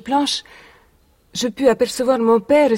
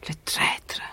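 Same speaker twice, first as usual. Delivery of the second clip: bitter whisper; 9 dB quieter than usual. Acted. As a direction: hiss it voiceless with contempt. Names her father's murderer.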